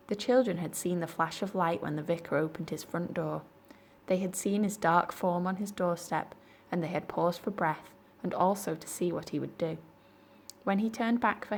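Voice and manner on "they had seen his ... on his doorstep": plain reading voice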